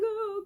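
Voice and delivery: high-pitched whimpered wail; thin, high fake sob; mock crying. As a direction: imitate her crying with a high "ohh".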